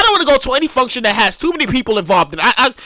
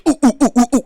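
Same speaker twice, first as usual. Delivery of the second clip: mock hooting; 3 semitones lower, much faster than usual.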